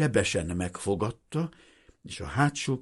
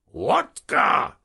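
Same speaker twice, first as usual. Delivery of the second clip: loud, raised-pitch soldier's roar; sudden bellow; 5 semitones higher, 6 dB louder than usual. A narrator's reading. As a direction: bellow the demand out of nowhere. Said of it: "ordította aztán minden átmenet nélkül". (hirtelen, hangosan ordítva)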